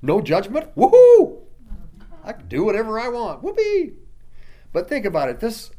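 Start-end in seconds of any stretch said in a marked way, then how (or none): 0.0-3.9 high, whooping mock celebration; giddy hooting parody of someone who thinks he can sin freely; mock gleeful sinner. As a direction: ham up a careless sinner cheering. Mock sinner cheering no judgment.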